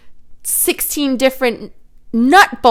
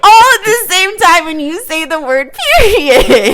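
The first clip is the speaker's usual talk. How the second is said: mock meltdown, building louder and higher; hammed-up emotional rant; 4 semitones higher, 5 dB louder than usual. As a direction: pile up the feelings, stretching out each one. Hammed-up rant listing clashing moods.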